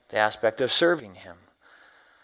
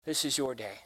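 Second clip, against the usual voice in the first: prayer; 3 semitones lower than usual. Acted. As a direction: give yourself to God in prayer. Speaker voices a morning prayer.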